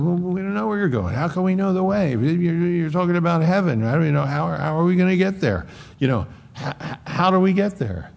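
a lightly voiced Thomas who can't follow; bewildered Thomas.

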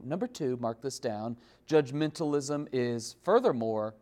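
usual speaking voice

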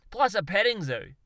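proud Aussie bloke landing his big finish; triumphant punchline. Acted. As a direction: crow out plus a petting zoo as the crowning idea. Darwin's punchline.

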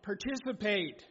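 normal talking voice

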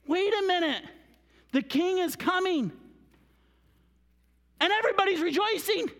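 high, loud, impassioned proclamation; full-force preaching. Real, not acted. Preacher telling children and parents only Jesus saves, shouted high. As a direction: cry it out to the whole room.

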